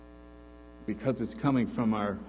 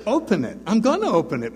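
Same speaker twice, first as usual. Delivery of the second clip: raised urgent voices; 9 semitones higher, 6 dB louder than usual. Retold or imitated. Mother and son exchange, urging.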